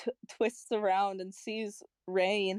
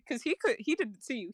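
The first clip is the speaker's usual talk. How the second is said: normal talking voice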